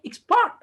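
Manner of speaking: expert with the second syllable pitched high and drawn out; stress shift. Shown as a demonstration. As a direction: lean on the end of expert, ex-PERT. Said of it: "it gives another meaning".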